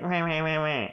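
high bratty meh-meh; childish mock bickering; mocking meh noises. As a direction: squabble in nonsense bleats. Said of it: "we would both be like".

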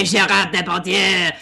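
Gérard Depardieu in a light French accent; mock French accent. Shown as a demonstration. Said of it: "I'm not French".

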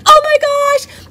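high squeal of delighted surprise; high thrilled oh my gosh; excited gasp. Retold or imitated. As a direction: gush like you just got the email. Retelling own excited reaction.

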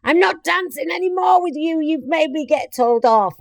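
playful shouted complaint to a dancing workmate; shouting across. Retold or imitated. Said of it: "And so I shout to Cameron".

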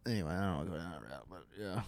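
quick, soft mumble, slightly stoned; high voice. Real, not acted. Guest backing off a tangent, high voice. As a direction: let it run together softly, like someone high. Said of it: "That was definitely your high voice".

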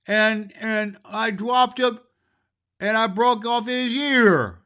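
put-on high, loud, slow child's voice, stammering through tears; a little girl wailing out her story; high tearful toddler voice, slow and halting. Mary tells how the cat broke.

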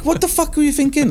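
high-pitched mock incredulity; incredulous outburst. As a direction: squeal it in mock outrage. Retold outrage, much higher.

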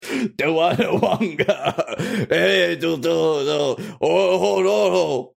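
very slow, stretched Duanawanga; drawn out. Acted. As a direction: draw out Duanawanga lazily. Repeats the word, drawn out.

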